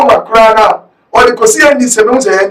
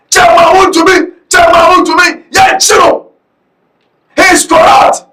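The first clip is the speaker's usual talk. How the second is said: high-pitched, forceful public accusation; real outraged shouting, pitched up; impassioned denunciation; 4 semitones higher than usual. Real, not acted. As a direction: call it out like a rally demand. Denouncing a chairman, demanding arrest.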